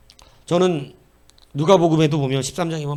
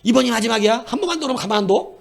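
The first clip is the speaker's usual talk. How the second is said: higher, fast stern threat; parent's final warning; 8 semitones higher, 4 dB louder, faster than usual. Retold or imitated. Parent threatening the child, higher fast stern warning. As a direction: warn the child it's the last time. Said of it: "그럼 또 뭐라 그래, 부모는?".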